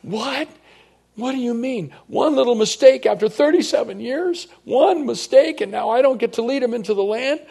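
high, loud, disbelieving protest; shocked outrage. Acted. Moses protesting to God after one mistake, shocked and loud. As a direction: cry out in disbelief. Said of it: "I can hear Moses say".

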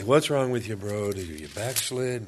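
teasing yell that eases off; mock yelling. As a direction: playfully imitate someone yelling a scolding, starting loud. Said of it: "Rob's gonna yell at me".

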